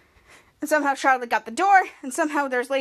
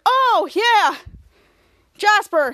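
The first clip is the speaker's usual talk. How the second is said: forced remembering; 6 semitones higher, 6 dB louder, much slower than usual. Retold or imitated. Ray covering awkwardly.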